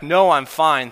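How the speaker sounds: retold player yelling he is fine; yelled back. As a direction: shout to the coach from the field. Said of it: "The player yelled back".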